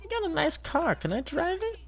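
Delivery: very high squeaky Leno voice; Jay Leno impression. Streamer imitating Vinny imitating Jay Leno, very high squeak. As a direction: squeak every line like a cartoon talk-show host.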